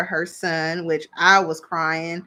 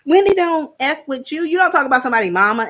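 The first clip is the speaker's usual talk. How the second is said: higher, louder, sassy declaration; louder, higher punchline; emphatic call-out; 7 semitones higher, 6 dB louder, faster than usual. Real, not acted. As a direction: hit it like a verdict. Calling out Nneka's comment.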